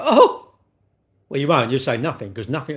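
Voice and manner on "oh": sudden high loud yelp; startled yelp. Retold or imitated. Tough guy startled when someone shouts boo, high loud yelp. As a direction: jump as if someone cried boo. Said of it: "and you'll go".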